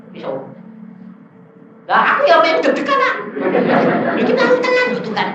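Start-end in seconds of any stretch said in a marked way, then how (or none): 1.9-5.3 high, loud nervous protest; re-enacted panicky complaint; acted alarm. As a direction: protest to God in a high, raised voice. Acting own alarm before God.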